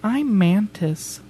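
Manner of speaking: slow, lightly voiced Mantis; a hint of shy alien Mantis; Mantis impression. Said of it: "you wanna hear my one impression".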